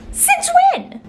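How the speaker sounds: high-pitched incredulous outburst. Real, not acted. Viewer questioning new movie powers, incredulous.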